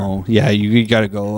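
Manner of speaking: ordinary delivery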